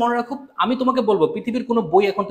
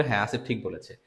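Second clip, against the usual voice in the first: mock uncle agreeing; 10 semitones lower, 7 dB quieter than usual. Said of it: "দেখো, uncle বলবে".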